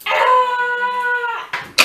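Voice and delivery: high, loud playful shriek; mock scream. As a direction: squeal as if the dragon caught you. Pretend fright in play.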